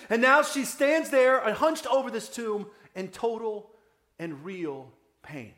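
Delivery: ordinary talk, nothing marked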